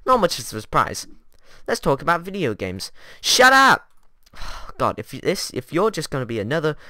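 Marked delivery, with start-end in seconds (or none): 3.2-3.9 shouting. Acted. Cuts off the other self.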